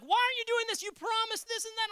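very high, exasperated protest; hammed-up complaint to God; frustrated complaint.

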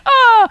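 high, loud sustained mock scream; piercing open "ah"; crowd scream. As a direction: imitate everybody screaming at once. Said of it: "everybody was just going".

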